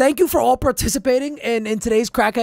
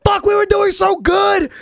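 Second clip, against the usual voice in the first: high, loud real yell of frustration; frustrated shout; 8 semitones higher, 4 dB louder than usual. Real, not acted. Streamer yelling at himself after botching the answer, real shout. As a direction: blow up at your own mistake.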